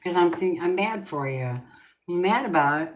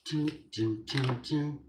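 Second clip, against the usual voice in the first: dramatic dun dun; 6 dB quieter, slower than usual. Pretend. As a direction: hum a suspense sting quietly. Dramatic reveal sound effect.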